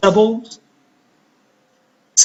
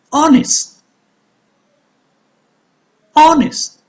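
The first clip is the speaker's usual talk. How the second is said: Honest with HON high and long; Honest, HON-est, careful teaching demo; stressed first syllable; 9 semitones higher than usual. Shown as a demonstration. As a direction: punch the first syllable of Honest, let the end fall. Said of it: "going to pronounce louder and longer".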